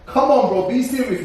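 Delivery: amused disbelief, laughing through it; laughing through a retort. Real, not acted. Laughing at the idea.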